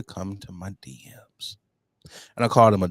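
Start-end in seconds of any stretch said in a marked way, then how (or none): 0.0-1.6 whispered aside. Real, not acted. Telling her co-host about the troll, whispered aside. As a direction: hush it like a knowing secret.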